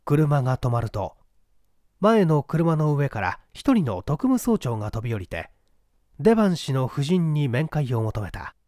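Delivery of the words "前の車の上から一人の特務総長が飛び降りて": usual speaking voice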